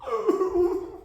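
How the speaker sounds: short high laugh. Real, not acted. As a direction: laugh at your own joke. Laughing after ow.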